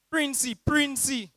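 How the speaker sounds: high, slow warning cry; alarm call. Retold or imitated. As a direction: sound the alarm to scatter. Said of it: "that alarm call".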